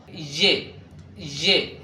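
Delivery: ي said as Urdu ژے, "zhe"; correct letter sound. Shown as a demonstration. Teacher models the correct Urdu letter, ي as "zhe". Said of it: "هذا هو نطقه الصحيح".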